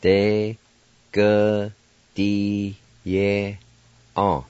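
De, ge, di ye, on said one syllable at a time with pauses; teacher sounding out a Cherokee word. Shown as a demonstration. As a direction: break De ge di ye on into separate syllables. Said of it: "now I'll pronounce these words".

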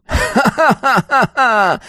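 high, drawn-out villain laugh; mad cackle. A narrator's reading. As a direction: laugh like a madman from above. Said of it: "хохотал наверху тот, как безумный". (безумный высокий хохот)

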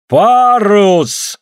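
loud, hoarse high shout. Acted. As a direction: call out with a soaked, hoarse voice. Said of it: "Голос у Суля тоже сырой, набрякший".

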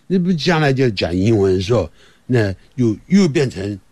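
ordinary talk, nothing marked

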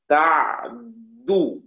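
"تعدو" with vowelless ayn held into a doubled dal; very slow "تعدو", stop on the ayn, then press the dal; Abu Jafar's reading. Shown as a demonstration. Teacher demonstrating Abu Jafar's reading, very slow "تعدو" with silent ayn and heavy dal. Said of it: "العين الساكنة مع تشديد الدال".

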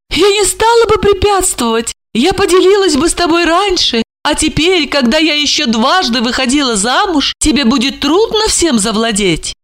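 high, anxious pleading voice; bewildered older woman. A narrator's reading. Olga pleads with Marina, high and bewildered. Olga's voice.

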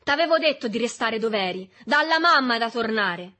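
sgridata rabbiosa, forte e acuta; rimprovero gridato. A narrator's reading. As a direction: rinfaccia urlando. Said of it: "gridò Berto in faccia ad Agostino". (rimprovero urlato)

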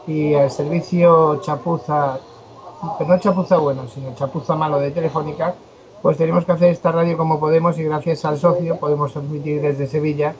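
ordinary talk, nothing marked

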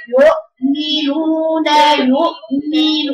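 drawn-out "يؤمنون" with a firm glottal break; detached hamza. Shown as a demonstration. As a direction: recite "يؤمنون", stopping the sound on the hamza. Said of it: "وأفصلها بين الهمزة وبين الميم".

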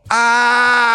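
long, loud, high scream of terror; high blood-curdling wail; frantic scream. A narrator's reading. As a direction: scream as if hunted down. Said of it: "вновь истошно закричал Ковальский".

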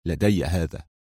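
low, soft, composed reply; understated cool confidence; calm assurance. A narrator's reading. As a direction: answer quietly, pitched well down. Unruffled hero declines the gun.

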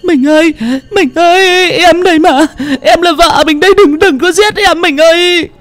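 bleeding wife begging her husband not to kill her; panicked crying out. A narrator's reading. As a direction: cry out desperately for him to stop. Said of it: "Mụ Trang hoảng hốt la lên".

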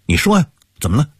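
rushed, urgent questioning. Acted. Uncle urges his brother to explain, speaking fast. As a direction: press him quickly, anxious for an answer. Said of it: "他加快了语速".

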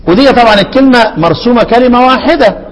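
ordinary talk, nothing marked

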